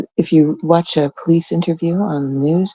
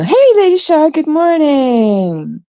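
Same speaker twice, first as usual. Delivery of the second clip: much higher, slow drawn-out good morning; sing-song greeting; 10 semitones higher, slower than usual. Real, not acted. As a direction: stretch the greeting high and slow. Greeting another chat member.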